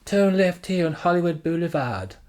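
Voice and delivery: imitating a London sat-nav voice; British sat-nav. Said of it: "Jane's from London ... She goes".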